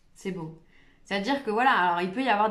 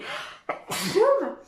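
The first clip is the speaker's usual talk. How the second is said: loud sudden sneeze; real sneeze, high and sharp; sneezing; 12 semitones higher, 5 dB louder than usual. Real, not acted. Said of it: "Ah, je vais éternuer".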